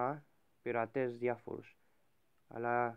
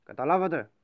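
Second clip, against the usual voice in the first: loud, high demand to the crew; captain's shout; 4 semitones higher, 9 dB louder than usual. Acted. Pirate captain yelling at his crew, loud. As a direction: yell it to make them obey.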